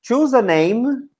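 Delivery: high, slow classroom instruction; teacher's instruction. Shown as a demonstration. Teacher telling beginners to invent names, slow instruction. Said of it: "when you say".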